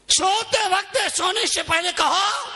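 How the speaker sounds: high, ringing instruction; rally the crowd to pray; exhorting preacher. Real, not acted. (ऊँची आवाज़ में आह्वान)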